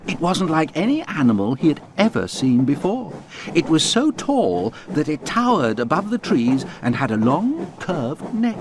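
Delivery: plain reading voice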